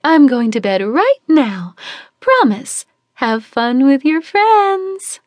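cheerful, carefree send-off; dreamy mother waving goodbye; breezy Celeste call. Acted. Celeste calling while leaving.

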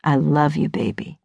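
low, affectionate husband voice; Curtis. A narrator's reading. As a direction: drop low and soften on the last word. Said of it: "Curtis kisses my nose".

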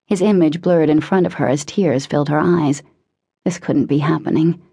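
ordinary delivery

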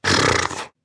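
lips blown raspberry; fart noise. A narrator's reading. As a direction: blow a rude raspberry. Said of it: "Lena-typisches Pupsgeräusch".

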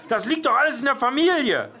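fast, high-pitched angry yell; shouting. A narrator's reading. Jörg yelling at grandma about the family, shouting. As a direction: yell the accusation in a rush. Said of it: "schrie er".

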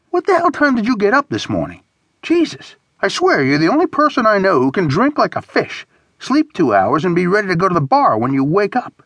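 high, exasperated disbelief; incredulous rant. A narrator's reading. Scolding friend who wants a morning bar, incredulous. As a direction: start very high and keep marvelling in exasperation. Narrator incredulous at Horace's drinking.

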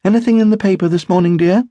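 high, quick casual question. A narrator's reading. Wife asks husband about the paper, casually. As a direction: ask lightly and briskly, pitched high. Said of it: "Barbara asked casually".

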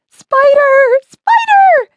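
very high, loud shriek of alarm; panicked shriek. A narrator's reading. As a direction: shriek the warning at the top of your voice. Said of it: "shrieked Betty".

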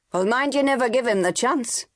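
mother's sharp snap. A narrator's reading. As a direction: cut him off sharply, mother scolding. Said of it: "Mary snapped".

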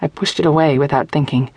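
ordinary delivery, nothing marked

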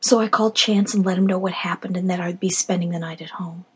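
ordinary delivery, nothing marked

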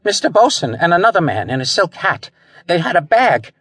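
high, flustered witness; stammered. A narrator's reading. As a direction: blurt it out high and rattled. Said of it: "he stammered".

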